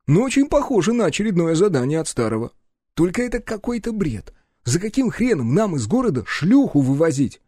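high-pitched, animated grumbling partner; brash sidekick. Acted. Partner objects to the boss's task, bewildered. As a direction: voice a sidekick protesting a senseless job.

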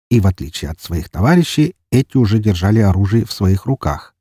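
normal talking voice

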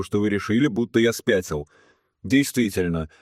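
plain reading voice

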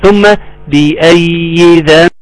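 slow, measured tajweed chant; Quran recitation. Shown as a demonstration. Teacher reciting verse after verse for students, slow recitation. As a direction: hold the long vowels and nasal noon.

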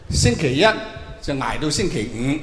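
normal talking voice

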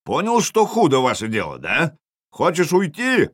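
goading, high-ending yell; jarl yelling back. A narrator's reading. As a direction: call the challenge over to the enemy. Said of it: "заорал Ярл".

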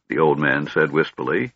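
usual speaking voice

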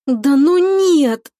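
slow, loud, high groan; martyred groan. A narrator's reading. Girl overhearing the cousins' call, tormented groan. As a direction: drag it out in agony of embarrassment. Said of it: "Слышу мученический стон Агапова".

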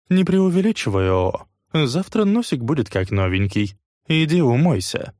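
high, offhand boss voice; dismissive boss. Acted. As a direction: brush her off lightly. Noe brushes girl off. (пренебрежительный главарь)

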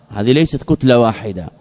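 ordinary delivery

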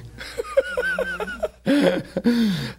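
long high laugh; incredulous laugh. Acted. Imagining Jesus marveling at unbelief, high incredulous laugh. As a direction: laugh in mock disbelief, high.

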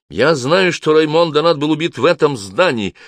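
high, loud, insistent charge; forceful accusation. A narrator's reading. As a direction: press the accusation home. Friar accuses guard of murder.

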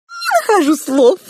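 very high, loud mouse voice; overwhelmed little mouse. A narrator's reading. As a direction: squeal it like an awestruck mouse. Pink mouse overwhelmed by girl's beauty. (восторженный писк мышки)

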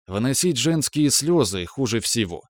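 usual speaking voice

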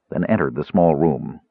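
usual speaking voice

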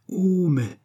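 high, drawn-out exclamation; crying out. A narrator's reading. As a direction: cry out in indignant astonishment. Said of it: "s'écria l'abbé Fortier".

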